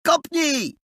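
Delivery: high, urgent cry; sudden high-pitched command; shouted order. A narrator's reading. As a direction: yell it to a mate in a high voice. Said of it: "krzyknął Adaś".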